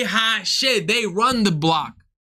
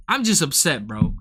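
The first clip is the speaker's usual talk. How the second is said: ordinary talk, nothing marked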